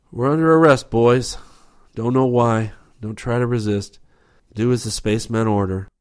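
loud, high call to the group; calling to his men. A narrator's reading. Rip calls to his men across the asteroid, loud call. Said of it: "He called to his men".

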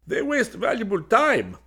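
a frightened follower crying out; panicked henchman. A narrator's reading. Henchman protesting to Golgotha, panicked.

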